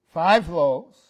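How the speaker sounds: FIVE LOAVES punched loud; emphasizing. Retold or imitated. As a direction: hit five and loaves hard. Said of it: "He's emphasizing".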